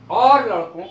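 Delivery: loud hail to the lads; calling out. Shown as a demonstration. Hailing a group of boys, calling out. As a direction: belt out a casual greeting. Demo greeting to seated boys.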